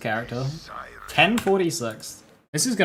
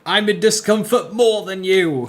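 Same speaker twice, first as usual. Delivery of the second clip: mock whiny; 6 semitones higher, 5 dB louder, slower than usual. Mock whiny competing complaint.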